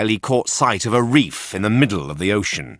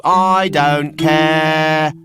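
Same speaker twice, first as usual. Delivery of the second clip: higher, louder, slow defiant I don't care; defiant puppet; 6 semitones higher, 5 dB louder, much slower than usual. A narrator's reading. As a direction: shrug it off boldly. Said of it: "said Pinocchio".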